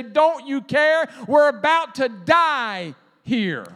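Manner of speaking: loud, high, slow desperate plea; frightened disciples. Retold or imitated. Disciples waking Jesus in the storm, loud desperate plea. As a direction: beg like men about to drown.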